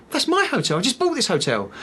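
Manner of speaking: imitating a client's high-pitched surprise; surprised client. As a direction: voice his startled recognition, pitched well up. Retells client recognising own hotel.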